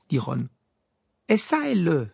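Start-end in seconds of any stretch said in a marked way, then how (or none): 1.3-2.1 higher, louder, zealous spelling-out; fervent Hermione. A narrator's reading. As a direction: hammer out each letter with fervour. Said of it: "répéta Hermione avec ardeur". (avec ardeur)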